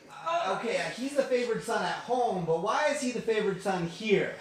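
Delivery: loud, high-pitched mock complaint; indignant protest.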